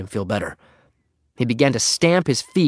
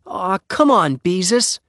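put-on high, coaxing boy's plea; pleading; 8 semitones higher, slower than usual. A narrator's reading. Henry begs Beezus to let him out, pleading. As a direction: plead with a friend to give in. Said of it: "he pleaded".

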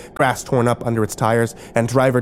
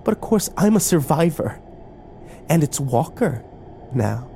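cool, smug coo, pitched up; 5 semitones higher, 3 dB quieter than usual. A narrator's reading. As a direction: coo it coolly as mistress of the house. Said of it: "Emma cooed".

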